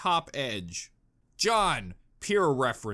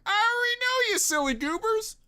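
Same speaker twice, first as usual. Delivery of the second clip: high, singsong teasing; mock know-it-all. Teasing viewers in a high singsong voice. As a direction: tease them in a high playful voice. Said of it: "I'm like".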